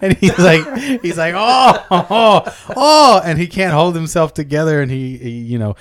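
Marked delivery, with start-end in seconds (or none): 1.3-3.2 high, loud "oh"s building; comic ecstatic moans; mock climax moans. Customer losing control with the madam, mock ecstatic moaning.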